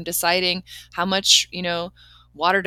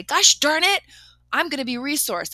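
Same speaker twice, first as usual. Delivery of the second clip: mock mad outburst; 6 semitones higher, 5 dB louder than usual. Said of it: "a little bit mad when I said it".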